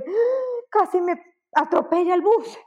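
high startled gasp, then a shaken blurt; imitated near-miss panic, pitched high; mock fright. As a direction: act the shock of nearly being hit by a bus. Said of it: "la mano al pecho".

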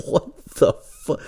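slow, breathy whispered disbelief; stunned whisper. Retold or imitated. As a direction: whisper it slowly, too shocked to speak up. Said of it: "I'm like".

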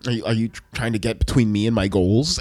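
low, menacing mock villain; imitated snide interrogation, low and soft; Light. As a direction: challenge them in a low, contemptuous voice. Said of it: "the attitude of, like, looking at".

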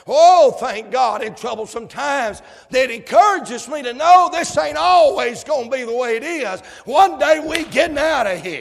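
loud, high joyful preacher cry; exultant preacher; joyful preaching. Acted. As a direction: celebrate the hope aloud. Celebratory preaching.

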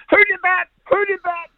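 high, loud mock-outraged shout; furious roar. Club secretary roaring at the team over the prank, imitated furious shout. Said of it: "roaring out onto our".

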